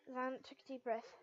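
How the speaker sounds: usual speaking voice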